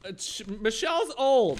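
high, loud mocking comic voice; mock bad comedian.

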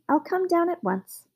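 high, willing call; cried out. A narrator's reading. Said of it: "cried the old woman".

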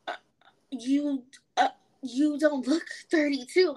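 stalled start with pauses between words; imitated hesitant reply. Coworker reacting to the teller's age, halting and hesitant. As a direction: mimic her stumbling carefully into the words. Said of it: "me doing these pauses".

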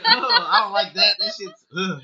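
high, loud, laughing recoil; amused, grossed-out retelling; laughing disgust. Retold or imitated. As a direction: squeal through laughter at something gross. Laughing through retold disgust.

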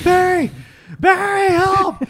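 high, loud mock cry for help; retold scream. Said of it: "so I'm screaming Barry".

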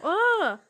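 loud high frustrated ugh; annoyed groan. Real, not acted. Searching for the video, a loud high annoyed groan.

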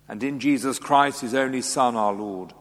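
ordinary delivery

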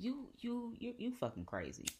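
mild mock exasperation, stammered start; imitated mom. Mom about to hang up, lightly imitated exasperation. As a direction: lightly imitate a mom giving up on her child. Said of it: "And she was like".

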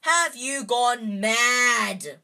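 slow, loud furious bellow. A narrator's reading. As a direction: roar it at her in disbelief. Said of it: "Ron bellowed".

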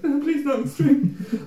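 put-on high, slow pleading like a small boy; mock-timid little boy begging; frightened little boy. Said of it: "I turned into a little boy".

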